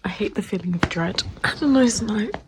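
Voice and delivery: hushed late night whisper. Real, not acted. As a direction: whisper so no one wakes.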